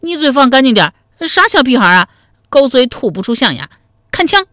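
put-on high, loud, indignant child voice; childish anger, pitched high; angry little boy. A narrator's reading. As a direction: fire back like an offended small kid. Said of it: "一脸怒气地说".

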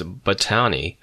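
botany stressed on its second syllable, bo-TAN-y. A narrator's reading. Student asking for a textbook, botany mis-stressed as bo-TAN-y. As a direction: say botany as bo-TAN-y, accent on the middle. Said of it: "with the accent on the second syllable".

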